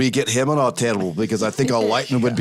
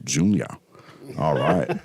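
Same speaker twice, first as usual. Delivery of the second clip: lower, clipped single word as Junior; Junior impression; 6 semitones lower than usual. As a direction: drop into a gruff Indiana Jones dad voice. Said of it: "if you want me to do Junior".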